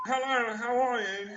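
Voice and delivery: lightly voiced silly charmer; hammed-up hello. Shown as a demonstration. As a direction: toss it off as a joke. Mocking the voice girls like.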